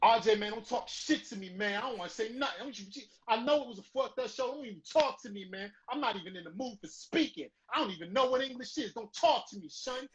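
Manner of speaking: high-pitched, heated New York rant, hammed up; one hundred New York. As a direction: act out a fed-up New Yorker refusing to talk. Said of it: "one hundred New York".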